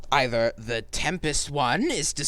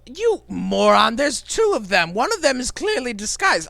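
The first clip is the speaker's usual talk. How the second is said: exasperated scolding; 8 semitones higher, 3 dB louder than usual. Acted. Exasperated boss scolds henchman.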